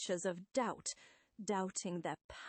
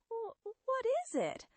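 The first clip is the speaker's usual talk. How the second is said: high, stammered question; fearful. Acted. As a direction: stumble on the first word in alarm. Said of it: "I seem to hear fear in your voice".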